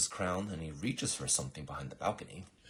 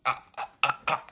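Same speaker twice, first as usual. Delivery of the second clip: high, loud broken "ah" noises; flustered stammer; 9 semitones higher, 9 dB louder than usual. Acted. Assassin asked his name, flustered wordless stammering. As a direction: stutter helplessly on one sound. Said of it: "He doesn't know how to react".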